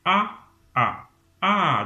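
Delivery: each "A" high and falling, loud; falling A repeated. Shown as a demonstration. Teacher drilling the falling tone A. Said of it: "un ton qui monte et puis un ton qui descend".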